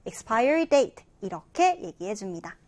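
ordinary talk, nothing marked

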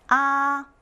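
Mandarin first tone on 아. Shown as a demonstration. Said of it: "일성이에요".

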